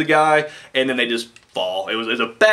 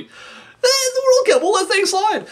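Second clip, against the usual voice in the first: mock lax referee; slower than usual. Referee voice again.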